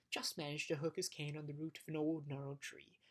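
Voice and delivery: plain reading voice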